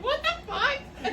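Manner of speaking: slow, high, laughing what-the; laughing mock shock. Friend imagining being there, drawn-out laughing disbelief. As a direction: stretch it out in disbelief through laughter. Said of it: "I would have been like".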